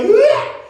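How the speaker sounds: high, loud yelp of fright; short high-pitched shriek; retold scream. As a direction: scream as the plane drops. Reacts to the plane dropping.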